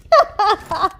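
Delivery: loud, high laughing burst; high burst of laughter. Real, not acted. Caster cracking up at the players, loud high laugh. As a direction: crack up at the screen.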